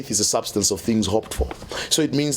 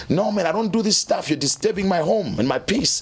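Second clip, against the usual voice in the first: imitating an irritated boss, pitched higher; mock-angry homeowner shooing him away; annoyed boss; 7 semitones higher than usual. Boss angrily chasing him off.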